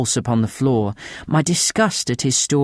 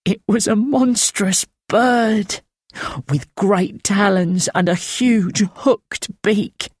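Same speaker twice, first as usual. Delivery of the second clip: higher, slow, rasping horror; slow thin rasp; 9 semitones higher, slower than usual. A narrator's reading. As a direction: draw it out slowly, thin and haunted. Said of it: "His voice a thin, rasping trickle".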